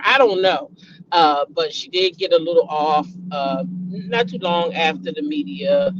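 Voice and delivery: usual speaking voice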